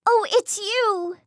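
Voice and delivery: high, slow cry of relief; tearful relief. Acted. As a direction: let the relief pour out. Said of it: "Hades cried with relief".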